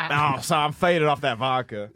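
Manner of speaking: slow, drawn-out comic caricature; hammed-up lazy-paced leader impression; slow mock-character riff.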